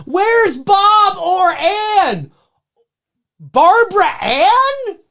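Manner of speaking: high, slow, drawn-out cry; hammed-up high crying call; mock wailing call. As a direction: wail the name like calling for someone lost. Repeating the mishearing joke.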